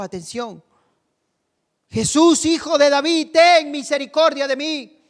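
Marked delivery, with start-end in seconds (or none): 1.9-5.0 high, sustained plea for mercy; crying out. Acted. Blind man ignoring the crowd, crying out to Jesus. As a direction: call out high, undeterred.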